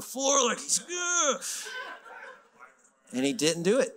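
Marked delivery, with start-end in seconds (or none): 0.8-1.4 high-pitched imitated groan; squirming groan. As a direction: groan like a friend avoiding attention. Imitates friend squirming, looking down.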